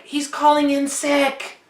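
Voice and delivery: tattling wife. Retold or imitated. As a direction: sing out the excuse like a tattletale. Calpurnia tattles on Caesar.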